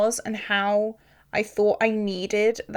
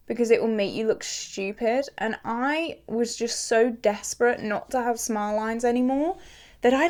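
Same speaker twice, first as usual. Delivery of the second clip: ordinary talk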